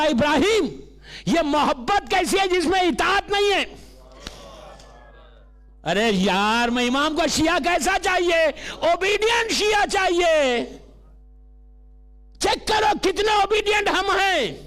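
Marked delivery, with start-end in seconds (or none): 0.0-3.6 impassioned rebuking question; high, projected sermon voice; preaching. Acted. Imam questioning Ibrahim's love without obedience, preaching. 6.7-14.6 high, ringing exhortation; preaching at full force. Acted. Preacher demanding obedient Shia from the congregation, full-force preaching.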